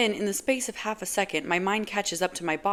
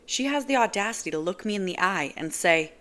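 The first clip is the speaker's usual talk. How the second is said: usual speaking voice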